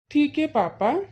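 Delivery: put-on a small kid asking papa for ice cream; little boy. Child agrees with father. (छोटे बच्चे की आवाज़)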